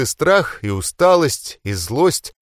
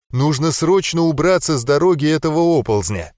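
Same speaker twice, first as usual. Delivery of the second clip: plain reading voice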